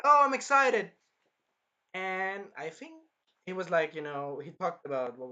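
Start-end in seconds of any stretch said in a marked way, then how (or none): 0.0-0.9 mock excited.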